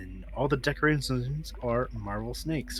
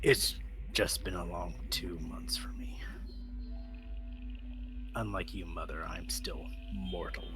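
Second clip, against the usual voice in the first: weary and quiet; 5 semitones lower, 10 dB quieter than usual. Acted. Rika answers her mother wearily.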